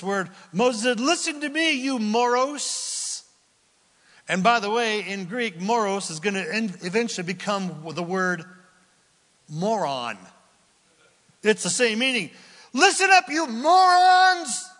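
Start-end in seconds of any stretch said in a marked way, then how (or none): 1.0-3.2 angry rebuke. Acted. Moses scolding the people. 12.7-14.7 much higher, much louder, with a slow, drawn-out morons; furious shout. Acted. Moses yelling at the crowd.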